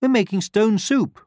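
very high, loud hen; hen character. A narrator's reading. Hen welcoming the pig at her door, calling out. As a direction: call them in with a high sing-out. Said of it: "said the hen".

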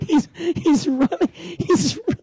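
very high, squeaky laughing voice. Real, not acted. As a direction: gasp the words through laughter. Laughing while reporting the chase.

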